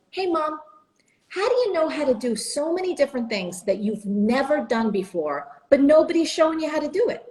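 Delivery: put-on high, eager child's question; young daughter. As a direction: ask mom in a wide-eyed kid voice. Asking mom the big question.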